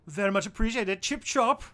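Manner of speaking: high, loud hammed-up posh British caricature; exaggerated British accent, high and loud; mock British accent. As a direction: play a cartoon Englishman, way over the top. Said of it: "this dirty British accent I do".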